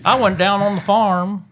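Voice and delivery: high, slow Southern drawl; mock Southern accent. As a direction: ham up a country Southern accent to egg them on. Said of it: "Can you do that Southern accent".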